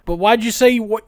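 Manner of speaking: high, loud imitation of a bewildered son; mock confused protest. Son questioning his father's old advice, mock confused protest.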